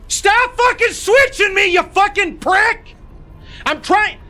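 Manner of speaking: very high, loud enraged yell; furious screaming. Real, not acted. Gamer screaming at the game for switching characters, furious high yell. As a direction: scream at the game in real fury.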